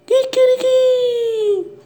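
high, slow rooster call; rooster crow. Pretend. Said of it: "Y la gallina".

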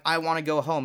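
ordinary talk, nothing marked

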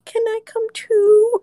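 high, slow, timid little voice; pleading little voice. Retold or imitated. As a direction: ask sweetly like a small child. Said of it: "she was like".

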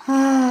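big sigh. Shown as a demonstration. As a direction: let out a long audible sigh. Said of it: "e exala com suspiro".

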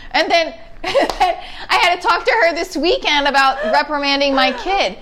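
normal talking voice

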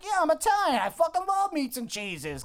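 high-pitched mock Italian guy; Girardi. Girardi answering the boss's offer, hammed-up impression. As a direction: ham up an eager Italian character. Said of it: "'cause that's how Girardi talks".

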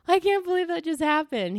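high-pitched incredulous exclamation, near giggling; retold cracking up. Host retelling the mishap to listeners, high giddy disbelief. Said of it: "I just start cracking up".

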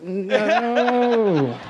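high, long drawn-out cartoon cry; mock villain's wail. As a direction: stretch it into a defeated villain's wail. Villain's final cry in freeze frame.

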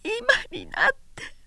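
high, soft cry of pain; pained moan. Acted. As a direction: moan it in anguish. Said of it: "苦痛のうめきのように言った".

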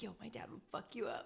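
a laughing whispered threat, voice unvoiced. Friend telling a shady guy her dad will get him, whispered. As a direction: breathe the threat through a giggle.

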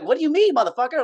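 retelling his furious reply to demanding coworkers; indignant outburst. Retold or imitated. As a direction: fire it back at the coworker, half-acted. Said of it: "and I'm like".

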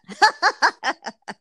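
loud burst of laughter; high, bright laughing burst; big laugh. Real, not acted. Laughs at own joke.

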